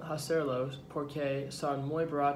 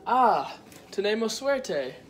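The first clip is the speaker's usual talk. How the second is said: higher, louder pleased exclamation; pleased excitement; 7 semitones higher, 6 dB louder than usual. Acted. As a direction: exclaim at a lucky find, pitch up. Acted happy find while cooking. (exclamación alegre y emocionada)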